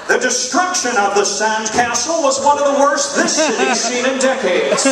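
hammed-up dramatic news delivery; fake serious newscaster voice. Comic mocking how reporters talk, fake serious and dramatic. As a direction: parody a grave live-shot reporter. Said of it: "that fake serious voice".

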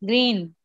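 ग्रीन with the double-e held; long ee. Shown as a demonstration. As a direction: hold the vowel in ग्रीन, like the colour. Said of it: "उसको थोड़ा सा खींचा जाता है".

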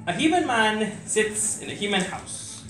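slow, hushed spoken word delivery; poem recitation. Acted.

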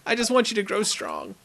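ordinary delivery

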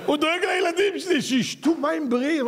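high laughing repeat; mock him through laughter; laughing mockery. Real, not acted. Preacher to audience, laughing while mocking the salesman.

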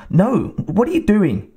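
high, panicked cry of alarm; frightened cry. A narrator's reading. Woman grabbed by an attacker cries out in fright. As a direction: cry out as he grabs her.

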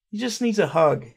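ordinary delivery

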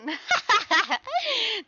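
high rolling laugh; giggling cackle. Real, not acted. Streamer cracking up at own catchphrase, high laugh.

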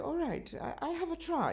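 mildly imitating her younger self; retold own reply. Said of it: "And I said".

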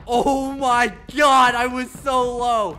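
relieved shout. Real, not acted. Survived on low health.

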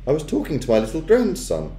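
put-on high, unruffled old-lady voice; grandmother. A narrator's reading. Grandmother explaining herself to the witch, unruffled. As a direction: answer high and calmly. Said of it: "I heard my grandmother saying".

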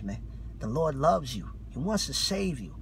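usual speaking voice

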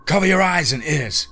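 loud, high urgent shout; yelled warning. A narrator's reading. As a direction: yell a warning to a comrade. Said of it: "yells O'Neill".

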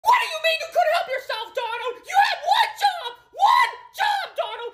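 angry shout. Acted. Mickey scolding Donald for eating his food, furious shout. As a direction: rage at him over the eaten food.

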